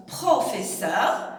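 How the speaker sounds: professeur drawn out slowly, every syllable clear; teacher saying the long form professeur slowly; slow full word. Shown as a demonstration. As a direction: spell out professeur slowly for the class. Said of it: "au lieu de dire".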